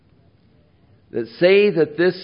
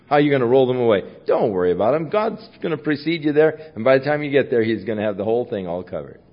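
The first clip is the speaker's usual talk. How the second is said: ordinary talk, nothing marked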